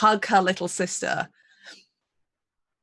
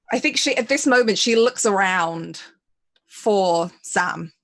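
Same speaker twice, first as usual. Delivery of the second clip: ordinary talk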